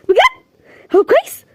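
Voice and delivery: very high, loud bjd; surprised joy. Acted. Mother hearing her son woke up, very high surprise. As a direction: leap at the good news. Delighted news son is awake.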